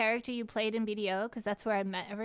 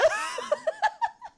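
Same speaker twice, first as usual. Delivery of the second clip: high squealing laugh; 4 dB louder than usual. Real, not acted. Streamer retelling her first kiss, squealing laughter.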